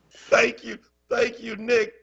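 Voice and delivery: high-pitched thanks after laughing; amused, emphatic thanks. Real, not acted. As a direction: thank them fresh from cracking up. Thanks viewer right after laughing fit.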